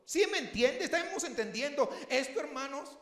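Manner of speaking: loud, high fervent sermon delivery; impassioned pulpit cry; full-force preaching. Acted. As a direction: preach it hard to the congregation.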